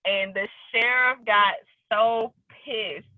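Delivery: ordinary talk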